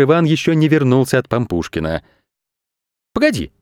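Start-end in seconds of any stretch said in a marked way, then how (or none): 3.1-3.5 high, quick interjection; acted interruption; interrupting. A narrator's reading. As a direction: cut in suddenly. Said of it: "перебил директор Простокваша".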